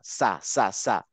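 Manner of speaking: সা hissed without throat vibration; unvoiced সা, air only; voiceless sa. Shown as a demonstration. Teacher shows class voiceless সা. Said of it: "কোনো রকম vibration create করে না".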